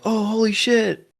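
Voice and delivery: high-pitched imitation of gushing moviegoers; mock fan excitement. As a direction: gush like a starstruck audience member. Voicing excited audience at movie.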